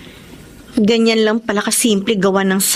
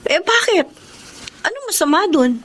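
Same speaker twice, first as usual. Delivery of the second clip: sharp, higher defensive question; 7 semitones higher than usual. Acted. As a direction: push back, a little hurt. Defensive reply to friend.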